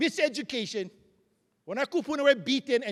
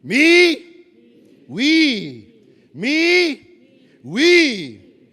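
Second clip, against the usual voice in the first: call and response; 10 dB louder, much slower than usual. Shown as a demonstration. Speaker leads the hall in a call-and-response chant. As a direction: chant it back and forth with the room. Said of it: "So repeat after me".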